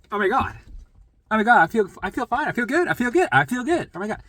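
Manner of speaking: high, loud, gushing disbelief; hammed-up thrilled amazement; imitated amazed relief. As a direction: act out an astonished convert, repeating it excitedly. Acts out amazed people relieved of pain.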